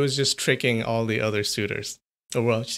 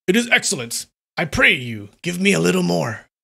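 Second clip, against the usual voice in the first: higher, livelier voice of the pleased samurai; eager samurai; 5 semitones higher, 5 dB louder than usual. Shown as a demonstration. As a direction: say it like he truly relishes it. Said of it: "let me do that again".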